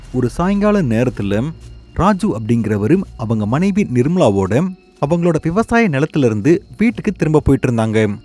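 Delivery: ordinary delivery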